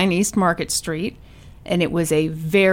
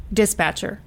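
ordinary delivery

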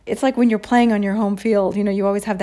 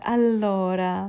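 drawn-out filler; much slower than usual. Shown as a demonstration. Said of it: "Americans do use that a lot".

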